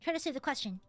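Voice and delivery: usual speaking voice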